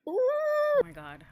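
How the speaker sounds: real shock at the launch; stunned gasp. Real, not acted.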